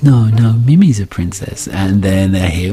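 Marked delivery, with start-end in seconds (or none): 0.0-1.7 high, drawn-out motherly gushing; doting mum. Retold or imitated. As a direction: gush back sweetly at a toddler. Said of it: "my wife goes".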